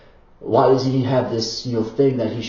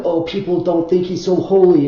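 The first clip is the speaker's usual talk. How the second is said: imitating believers, higher and louder; put-on offended piety, raised; mock pious voice; 7 semitones higher, 8 dB louder than usual. Mocking believers offended by disrespect, in a high, loud put-on voice. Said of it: "They're like".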